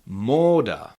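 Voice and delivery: Moda with a drawn-out first O, falling at the end; long O demonstration. Shown as a demonstration. Teacher for learners to repeat, Moda with a long O. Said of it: "you can repeat after me".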